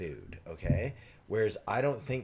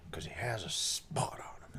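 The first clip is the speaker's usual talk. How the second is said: whispered; 5 dB quieter than usual. Retold or imitated. Old lady's punchline, retold.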